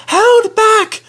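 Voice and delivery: very high squeaky held back; high conspiratorial squeak; whispered coach voice. A narrator's reading. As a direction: pass the secret like a giggling coach. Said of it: "whispered Ruth to Jemima".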